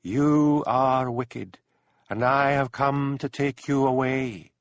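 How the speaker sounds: high, very slow ghostly voice, otherworldly; unearthly voice. A narrator's reading. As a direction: drag each word out like a spirit from the heather. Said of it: "silvery, unearthly voice whispered".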